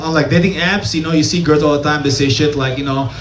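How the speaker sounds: normal talking voice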